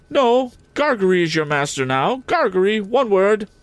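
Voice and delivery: cold lady of the house looking them over; Miss Havisham. A narrator's reading. Miss Havisham addressing Joe, haughty.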